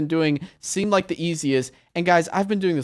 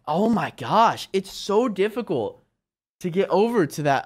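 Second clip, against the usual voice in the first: ordinary delivery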